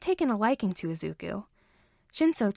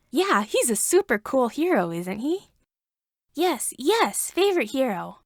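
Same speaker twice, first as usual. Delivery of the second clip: loud, higher eager talk; excited; 3 semitones higher, 4 dB louder than usual. A narrator's reading. Boy and babysitter gush about Eraserhead, excited. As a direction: gush about a favorite hero.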